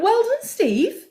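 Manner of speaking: high, slow cooing congratulations; mock-proud, very high; retold gushing praise. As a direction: praise him in a high, drawn-out voice. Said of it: "I was like".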